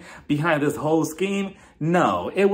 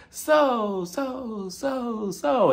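high, drawn-out words bouncing like an echo; mock echo, pitched up high; imitated echo; 8 semitones higher, much slower than usual. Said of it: "we're probably gonna hear their echoes".

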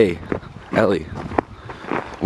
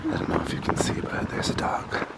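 whispering; 9 dB quieter than usual. Real, not acted. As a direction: hush so the dog stays put. Whispering near a dog.

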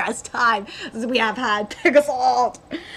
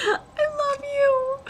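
high, drawn-out squeal; swooning fan falsetto; gushing adoration; much slower than usual. Real, not acted. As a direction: squeal it slowly, overcome with love.

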